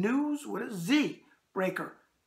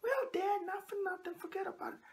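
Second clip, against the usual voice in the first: lightly voiced mobster, raised and hushed; tossing off a wiseguy brush-off, pitched up; Santino Corleone; 13 semitones higher, 6 dB quieter than usual. Answering his daughter in a light mobster voice. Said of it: "Okay, that's Santino Corleone".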